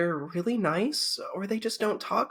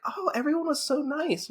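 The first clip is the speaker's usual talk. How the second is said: high, sugary mock outsider; 11 semitones higher than usual. Mimicking a newcomer who thinks everyone was nice. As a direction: gush like a naive newcomer. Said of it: "the outsiders will come in and be like".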